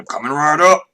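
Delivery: loud, lightly gruff barkeep; a hint of a gruff innkeeper. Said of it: "he says in a gruff voice".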